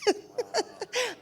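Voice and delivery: very high real laugh. Real, not acted. Laughing about Clinton's hurt feelings, high laugh. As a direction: let a real laugh squeak out.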